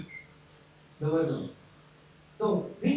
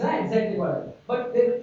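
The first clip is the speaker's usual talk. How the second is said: ordinary talk, nothing marked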